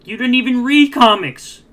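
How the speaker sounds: loud, incredulous call-out; exasperated shout. Real, not acted. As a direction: yell it in disbelief. Calling out Tony's comic claim.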